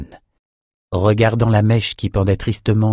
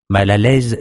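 usual speaking voice

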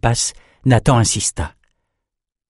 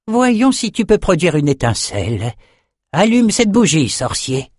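high, lilting sorcerer's voice; taunting André; 9 semitones higher than usual. A narrator's reading. André dares Nathan to light a candle, high taunting lilt. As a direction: play the master challenging his pupil in a high lilt.

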